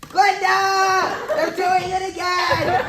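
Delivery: loud high shout. Acted. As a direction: yell across the house. Calling out to another room.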